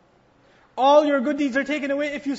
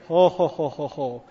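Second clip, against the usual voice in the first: low hearty ho ho laugh; amused preacher laughing at the story's turn; real laugh; 9 semitones lower than usual. Real, not acted. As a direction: laugh it off from the chest.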